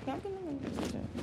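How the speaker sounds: soft mutter under her breath. Real, not acted. Jen denies being obsessed, quiet mutter. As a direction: grumble it to yourself. Repeats protest to herself.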